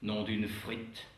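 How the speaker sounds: lightly voiced Belgian accent; mock Belgian accent. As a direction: toss off a Belgian catchphrase. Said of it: "Ou l'accent belge".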